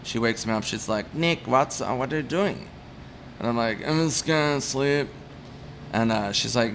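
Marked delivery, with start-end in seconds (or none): 1.1-2.7 lightly imitated German accent, pitched up; older neighbor's puzzled question in German accent; gentle mock German-accented concern; elderly German woman. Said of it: "German woman, she's, like, seventy". 3.9-5.1 slow, slurred imitation of being drunk; drawn-out, hammed-up drunk answer; mock drunken mumble. Drunk man answering from bushes.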